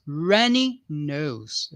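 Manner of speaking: "runny nose" stretched out very slowly, each syllable clear; "runny nose" drawn out, stress on runny; slow teaching repeat. Shown as a demonstration. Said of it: "teacher repeats a new word for learners".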